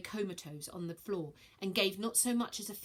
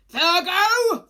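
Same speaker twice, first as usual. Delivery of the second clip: much higher, booming roar of her name; 11 semitones higher, 17 dB louder than usual. Acted. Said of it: "roared Piaces".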